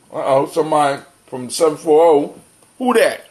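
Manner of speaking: usual speaking voice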